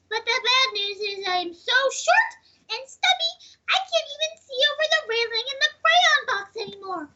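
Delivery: Blue Crayon voice. Acted. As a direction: plead for a break in a high small voice. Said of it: "I didn't know how many voices you could come up with".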